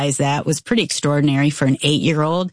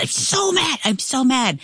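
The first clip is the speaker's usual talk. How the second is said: imitating her furious son, voice pitched much higher; mock angry little boy; 8 semitones higher than usual. Mom retelling her son's outburst, mock angry child voice. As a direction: play the mad eight-year-old, light and high.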